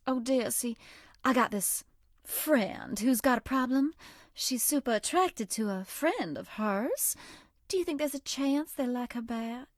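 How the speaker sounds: put-on a coy Southern girl sweet-talking a new friend; Southern belle character. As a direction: play the sweet, teasing Southern charmer. Voiced game character Bonnibel lines.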